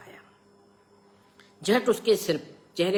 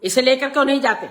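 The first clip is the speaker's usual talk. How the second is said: loud, high, fast reprimand; sharp scolding; 8 semitones higher, 11 dB louder than usual. A narrator's reading. Father ordering Prakash to take the boy away, scolding. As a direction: bark the order crossly. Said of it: "मैंने डांट कर कहा".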